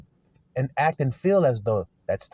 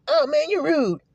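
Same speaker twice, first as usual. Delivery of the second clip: high-pitched mock whining; mock tearful griping; whining feelings; 14 semitones higher than usual. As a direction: play hurt feelings complaining in a squeaky-high voice. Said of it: "your feelings are the one going".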